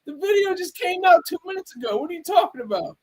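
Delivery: high-pitched mock outrage at the view count; disbelieving outburst. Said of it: "I'm like".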